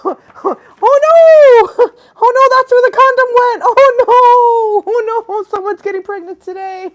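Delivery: high, loud wailing alarm; panicked story figure. Acted. Reacting to someone getting pregnant, high panicked wail.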